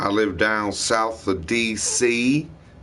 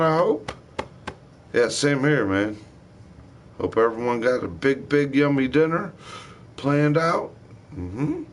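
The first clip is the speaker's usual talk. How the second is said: ordinary talk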